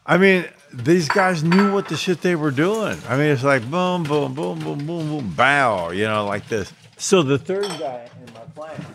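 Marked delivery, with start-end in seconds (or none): 3.7-5.9 imitated impacts. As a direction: mimic bodies thudding down one after another. Imitating jumpers landing in gravel.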